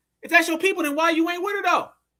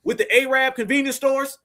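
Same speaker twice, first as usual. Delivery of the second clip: put-on pitch to a stranger, raised and emphatic; mock formal pitch; 2 semitones higher, 5 dB louder than usual. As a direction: call out like an overdone sales approach. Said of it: "not gonna talk to her like that".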